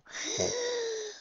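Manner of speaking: high filly gasp of delight; excited gasp. Acted. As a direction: gasp with thrill. Filly voice gasps.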